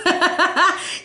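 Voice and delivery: high bright giggle after the cheer; happy giggle. Real, not acted. Right after cheering her birthday, a high happy giggle. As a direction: laugh at your own excitement.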